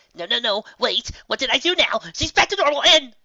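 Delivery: high, loud, rushing defensive protest; panicked pleading. Acted. Trixie pleading with Bon Bon in fear, high and loud. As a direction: blurt it out, scared she's in trouble.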